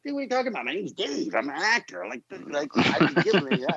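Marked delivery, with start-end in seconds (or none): 0.0-2.1 imagined blurt. Retold or imitated. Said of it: "I'm lucky I didn't go like".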